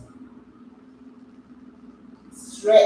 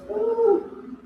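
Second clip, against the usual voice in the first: excited whoop. Real, not acted. Whoop during stretch.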